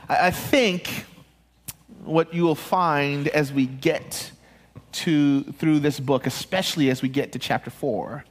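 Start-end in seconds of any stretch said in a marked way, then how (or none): none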